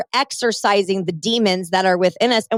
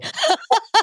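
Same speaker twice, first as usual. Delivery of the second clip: short high giggle; brief high laugh; 12 semitones higher than usual. Real, not acted. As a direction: let a giggle slip. Laughs at her own joke.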